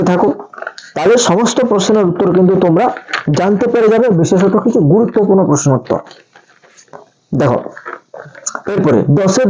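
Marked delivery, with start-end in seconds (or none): none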